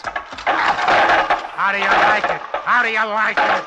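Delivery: frenzied shouting. Acted. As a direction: beat it home, shout in a frenzy. Beating the giant with iron.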